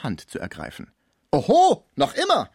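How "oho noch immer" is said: playful oho. Acted. Cibot pulls her hand away.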